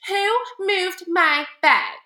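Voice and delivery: slow, high, loud demand; indignant flight attendant. Retold or imitated. Flight attendant demanding to know who moved his bag, mock outraged.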